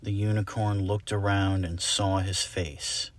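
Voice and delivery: usual speaking voice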